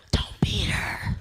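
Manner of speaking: whispered plea. Retold or imitated. Bystanders whisper to the comic not to fight, whispering. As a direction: urge her in a whisper. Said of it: "Two brown people going".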